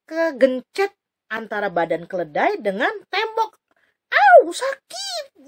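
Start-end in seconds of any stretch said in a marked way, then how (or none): 4.1-5.4 high, slow yelp with a laugh; hammed-up cry of pain, much higher. Bileam's leg is crushed, high hammed-up yelp. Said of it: "gitu ya".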